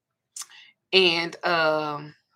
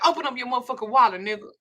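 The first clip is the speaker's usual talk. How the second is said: fast demand; 6 semitones higher, faster than usual. Retold or imitated. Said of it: "He was like".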